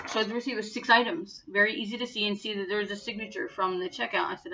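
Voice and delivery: normal talking voice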